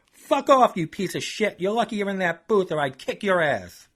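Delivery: loud, high angry yell; hammed-up rage; furious outburst. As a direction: curse him out, ready to fight. Chuck explodes at narrator.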